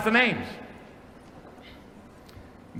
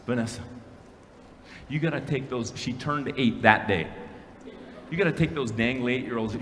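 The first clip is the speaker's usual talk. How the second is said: hushed coaching; 8 semitones lower, 8 dB quieter than usual. Retold or imitated. Dad coaching daughter before ride.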